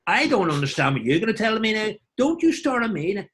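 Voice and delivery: high-pitched Northern Irish banter; Northern Irish accent. Shown as a demonstration. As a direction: do a hammed-up Northern Irish local. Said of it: "Northern Ireland, you go".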